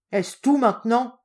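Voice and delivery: put-on loud, impatient old woman's grumble; grumbling. Old shopkeeper grumbling at the pestering customer, loud. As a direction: grumble it with open irritation. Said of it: "bougonne-t-elle".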